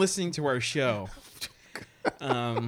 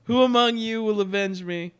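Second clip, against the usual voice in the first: high, loud dying plea; overacted death scene; 6 semitones higher, 5 dB louder than usual. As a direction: ham up a dying hero. Mock death after laugh track.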